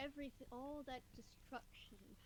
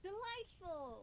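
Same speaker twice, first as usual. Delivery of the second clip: gleeful drawn-out delight; 7 semitones higher, 5 dB louder, much slower than usual. Acted. Player gleefully exclaims in character.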